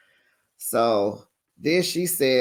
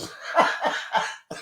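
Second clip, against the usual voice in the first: a high breathless laugh; a quick, giggly burst of laughter; real laugh; 12 semitones higher, 4 dB quieter than usual. Real, not acted. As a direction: crack up helplessly. Cracks up after oh no.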